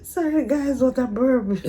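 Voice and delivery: high, loud laughing apology. Real, not acted. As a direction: laugh through it, embarrassed. Just burped and laughing.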